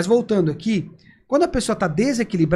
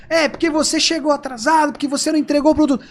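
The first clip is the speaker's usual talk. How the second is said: higher, insistent mock complaint; imitated angry customer; 6 semitones higher than usual. Upset client complaining of late delivery, raised mock-angry voice. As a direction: rattle off a raised-voice customer grievance. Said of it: "a pessoa tá falando mais alto".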